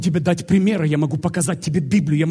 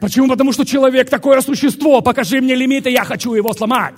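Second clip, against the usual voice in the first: loud, high, forceful declaration; rousing preaching; 8 semitones higher, 8 dB louder than usual. Real, not acted. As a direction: throw out a challenge to the limits.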